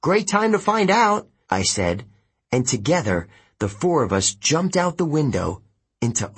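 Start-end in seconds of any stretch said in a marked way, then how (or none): 0.1-1.2 high-pitched call before a leap; urgent retort. A narrator's reading. Hero answering before they jump, calling out. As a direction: call it back, pitch raised.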